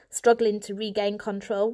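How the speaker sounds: usual speaking voice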